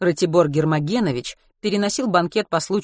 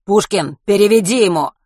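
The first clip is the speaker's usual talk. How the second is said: loud thug order; 5 semitones higher, 9 dB louder than usual. Acted. Thug calls to his partner.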